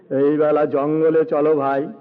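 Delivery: low, heavy elephant voice. A narrator's reading. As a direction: speak as a big elephant, pitched right down. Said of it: "হাতি বলে". (হাতির নিচু, ভারী গলা)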